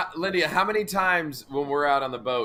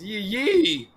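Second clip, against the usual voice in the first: "yee yee" with the second "yee" pitched up and stretched; stress on second YEE; 5 semitones higher than usual. Shown as a demonstration. Answering where the accent falls, stress on second "yee". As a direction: land hard on the last "yee". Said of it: "Is the accent on ye".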